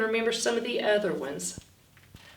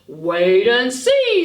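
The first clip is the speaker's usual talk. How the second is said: slow, louder teasing promise; 2 semitones higher, 9 dB louder, slower than usual. Acted. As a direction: draw it out like you're hiding a surprise. Teasing secret in story.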